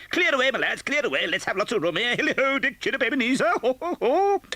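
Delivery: put-on rich, jolly old master's hail, pitched high; a hearty boss calling his lads; jovial voice. Fezziwig directs the lads.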